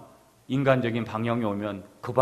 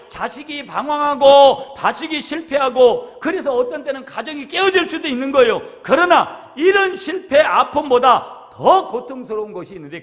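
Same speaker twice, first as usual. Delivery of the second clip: louder, higher, slowing to a cry on the hardships; full-force preaching; 10 semitones higher, 9 dB louder than usual. Acted. Pastor listing life's sufferings to the congregation, building to a cry.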